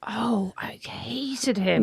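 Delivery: slow hushed whisper. Real, not acted. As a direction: whisper the confession. Admitting dislike of ex.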